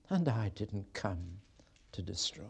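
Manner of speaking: fading to a whisper; hushed. Acted. God quietly promising mercy, hushed whisper. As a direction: let it die away gently. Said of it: "You can't read this without emotion".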